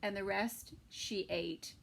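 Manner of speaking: plain reading voice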